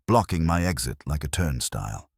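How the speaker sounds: plain reading voice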